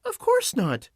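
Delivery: put-on high little-girl voice; high girlish reply; Dorothy answering. A narrator's reading. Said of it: "answered Dorothy".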